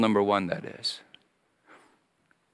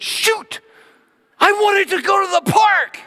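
put-on high, loud childish yell; disappointed kid; 4 dB louder than usual. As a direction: cry out like a let-down little boy.

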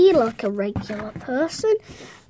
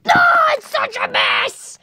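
high-pitched shout; high, strained cry of protest; upset outburst; 11 semitones higher than usual. Acted. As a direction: wail about it in dismay. Upset outburst over spilled cereal.

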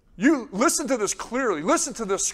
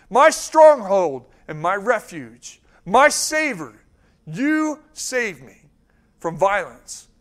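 slow, weighty reading of the psalm; measured pulpit reading, leaning hard on the key words; slow scripture reading; 6 dB louder than usual. Real, not acted. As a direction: read each phrase deliberately and let it land. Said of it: "my friend told me to read slow".

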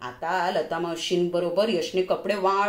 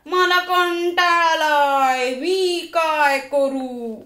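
put-on higher, louder, slow drawn-out child's wail; a kid yelling out his boredom to the house; a child's slow, loud bored howl; bored shout; 5 semitones higher, 6 dB louder, much slower than usual. A narrator's reading. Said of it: "तो एकदम ओरडला".

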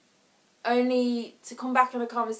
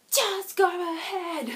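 higher, slow, fed-up snap; exasperated; 8 semitones higher, much slower than usual. Pretend. Stranger in the room ends the phone stalemate, exasperated. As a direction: cut through the stalemate, exasperated. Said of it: "someone just goes".

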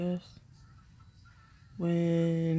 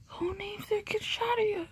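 higher, breathy whisper of disbelief; mock whisper; 13 semitones higher, 6 dB quieter than usual. Asking the vlog audience in a mock whisper. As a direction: whisper it like a scandalized secret.

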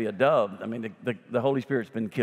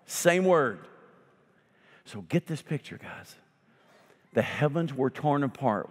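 ordinary talk, nothing marked

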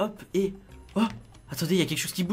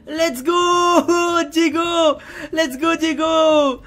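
loud, high, long-held cheer; triumphant cheer; 8 semitones higher, 9 dB louder, much slower than usual. Pretend. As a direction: whoop with joy at the rescue. Celebrates freeing his son. (cri de victoire étiré)

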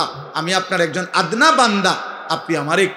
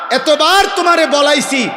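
much higher and louder shout; thundering reproach; 8 semitones higher, 5 dB louder than usual. Acted. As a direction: hurl the reproach from the pulpit. Preacher voicing God's reproach. (চড়া গলায় গর্জন)

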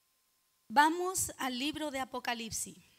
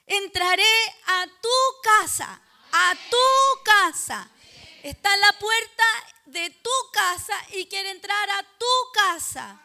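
loud, raised, drawn-out proclamation; rousing shout; 5 semitones higher, 11 dB louder than usual. Real, not acted. Preacher waking the congregation up to who is at the door, rousing shout. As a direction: belt it out, leaning hard on each "tu casa". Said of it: "Lo voy a decir de esa manera, a ver si se despierta".

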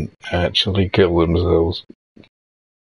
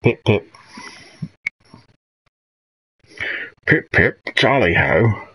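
put-on higher-pitched posh old British voice; 4 semitones higher than usual. Streamer imitating comedy sketch pilots, posh old voice. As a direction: chirp it as a posh old toff. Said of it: "like, this posh old voice".

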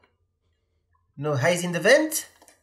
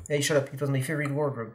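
ordinary delivery

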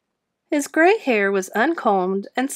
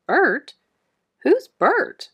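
higher, puzzled little squeak; puzzled hamster; 6 semitones higher, much slower than usual. A narrator's reading. Hamster puzzling over an unknown name. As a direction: ask it as a baffled hamster.

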